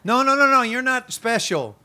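high, loud burst of imitated scolding; mock devil's yelling. Preacher imitating the devil putting down believers, mock yell. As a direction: burst in high and loud, then ease slightly. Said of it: "screaming at the church".

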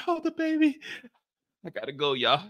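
put-on high fussy voice on the baby; mock parent leaving. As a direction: mimic someone rushing out the door.

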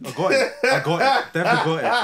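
high, loud false assurance; overeager fibbing. Retold or imitated. Lying to partner about the spider, high and insistent. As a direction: insist too hard, in mock confidence.

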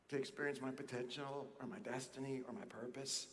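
very soft, low voice; hushed, low testimony of getting through; weary relief. Acted. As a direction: say it quietly, as if worn out from a long struggle. Voices believer weary from the struggle.

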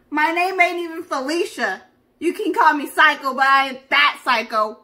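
indignant comeback. Retold or imitated. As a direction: hit back loud and offended. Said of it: "And I'm like".

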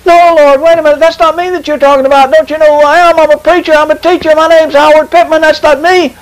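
high-pitched pleading, hurried out in one run; acted desperate protest. Acted protest to God's rebuke.

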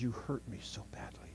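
whispered, hurt; tearful whisper. Retold or imitated. Hurt friend whispering to him in the yard. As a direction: breathe it out as a wounded confession. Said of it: "she says, tears in her eyes".

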